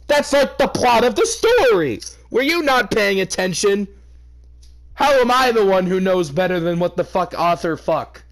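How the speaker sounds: a high, loud, disbelieving tirade; exasperated rant. Real, not acted. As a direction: yell at someone who missed the obvious. Reader rants at a confused listener.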